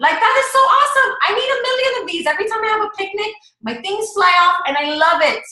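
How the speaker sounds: high, fast gushing over a favorite thing; genuine gushing. Shown as a demonstration. Coach demonstrating how to share a product, genuine gushing. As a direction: gush to friends about what works for you. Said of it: "that's how you do it".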